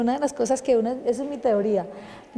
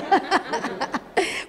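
light laugh; 5 semitones higher than usual. Real, not acted. Laughs after asking for help.

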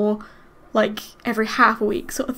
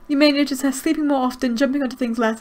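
ordinary talk, nothing marked

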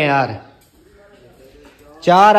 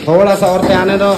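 थोड़ा सा औरतें आने दो called higher and louder, थोड़ा hit hard; calling out the fix again, louder and higher; louder insistent repeat; 6 semitones higher, 5 dB louder than usual. Real, not acted. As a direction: raise the voice and lean on थोड़ा to make the helpers hear.

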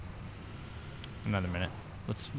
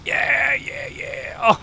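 very high, loud yelling; demolishing a car; excited shout; 7 dB louder, much slower than usual. Real, not acted. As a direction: whoop as you crash. Smashing car.

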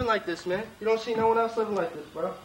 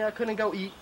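ordinary talk, nothing marked

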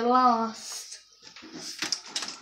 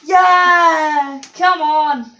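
real yell; 3 semitones higher, 12 dB louder, much slower than usual. Real, not acted. Goal celebration while playing.

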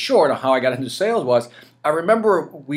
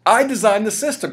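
higher and louder indignant protest; retold rising anger; 9 semitones higher, 3 dB louder than usual. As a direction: push back as the anger starts. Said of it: "now I started getting mad".

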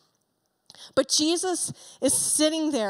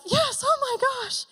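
much higher, gushing "Yes, oh my gosh"; excited fan; 11 semitones higher than usual. Pretend. Preacher imagining being in the temple crowd, thrilled and much higher. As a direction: squeal it like you finally met your hero. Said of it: "I'd be like".

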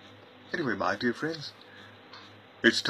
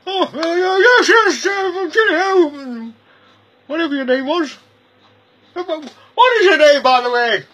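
much higher, frantic and flustered; a dithering little man squealing his words; high-pitched bumbling stranger, louder bursts; high squeaky visitor; 8 dB louder than usual. Acted.

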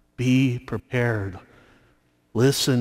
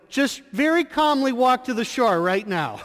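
firmer, higher insistent repeat; more insistent call; 10 semitones higher than usual. Retold or imitated. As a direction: press the request harder, still polite. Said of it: "but yet loudly and assertive".

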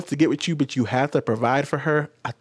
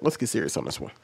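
ordinary talk

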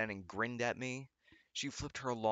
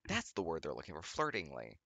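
usual speaking voice

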